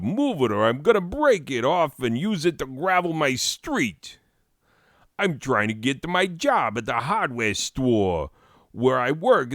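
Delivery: high-pitched, hammed-up New York accent; New York caricature. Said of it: "of people from New York City".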